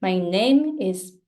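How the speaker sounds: "My name is" with NAME punched, is weaker; stress on NAME. Shown as a demonstration. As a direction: model "My name is" leaning on NAME. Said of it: "same thing for you".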